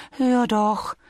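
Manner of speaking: high, light bee voice; little bee character. Acted. Bee reminds Bear what bears can't do, cautioning. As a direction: voice a small bee, patiently cautioning.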